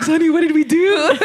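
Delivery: high, loud, laughing question; real laughter at her own retold panic. Real, not acted. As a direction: ask it while cracking up. Teller laughing, quoting herself.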